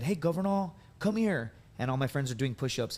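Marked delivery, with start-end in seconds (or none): 0.0-1.5 senior calling out. Retold or imitated. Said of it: "they said".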